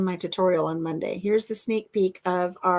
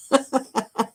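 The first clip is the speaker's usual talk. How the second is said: high quick giggle; real giggle in a short burst; laugh; 4 semitones higher, 3 dB louder than usual. Real, not acted. Tickled by her own funny word, giggling.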